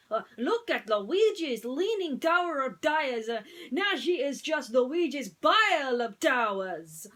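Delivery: loud lamenting Italian voice; Italian accent. A narrator's reading. Luigi mourning his fallen tires, loud Italian accent. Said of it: "my Italian accent".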